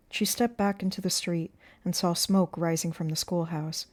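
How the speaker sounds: plain reading voice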